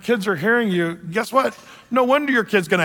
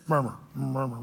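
softer and lower, fading murmur; murmuring sound; 6 semitones lower, 9 dB quieter than usual. Shown as a demonstration. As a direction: let the murmur sink almost to nothing. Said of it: "the word that sounds like it is".

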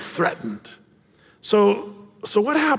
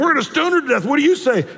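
accusing crowd; 8 semitones higher, faster than usual. Retold or imitated. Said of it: "these guys are like".

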